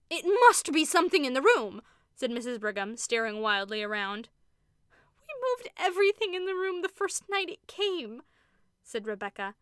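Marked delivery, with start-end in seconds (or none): 0.1-1.8 frightened. A narrator's reading. Sister frightened by the shadow. 5.3-8.2 frightened. A narrator's reading. Rebecca in horror.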